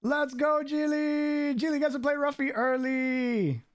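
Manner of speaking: high, sustained celebratory call; excited cheer. Real, not acted. Host hypes the winner getting early play, excited cheer. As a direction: cheer them on at the top of your pitch.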